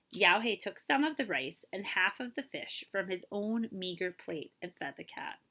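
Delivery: ordinary delivery, nothing marked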